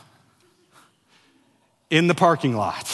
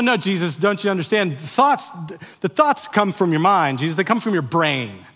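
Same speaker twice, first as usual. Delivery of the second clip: raised, higher pitched protest, explaining to Jesus; exasperated insistence; 4 semitones higher, 5 dB louder than usual. Retold or imitated. Worshipper protesting to Jesus, exasperated insistence. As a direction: argue the point as if he just doesn't get it. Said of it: "And we go".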